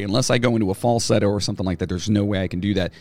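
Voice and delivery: usual speaking voice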